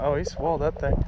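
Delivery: ordinary delivery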